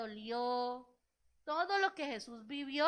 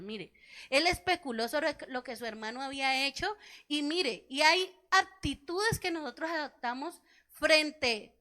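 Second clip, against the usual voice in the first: slow, louder preaching, leaning on each phrase; 6 dB louder than usual. Acted. Preacher urging the church to treat others as Jesus did, slow and loud. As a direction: press the point home to the congregation.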